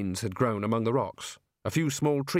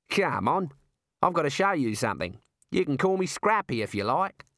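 higher, lilting Australian kangaroo voice; pitched-up matey Aussie critter, inviting; matey talking kangaroo; 3 semitones higher than usual. Acted. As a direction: play a chummy Australian kangaroo. Figure of the kangaroo.

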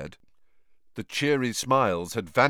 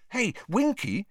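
Harry voice; 13 semitones higher than usual. A narrator's reading. Harry speaks to elf.

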